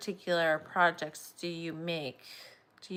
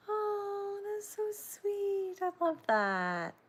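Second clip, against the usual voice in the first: delighted; 13 semitones higher, much slower than usual. Real, not acted. Reading a sweet sale comment.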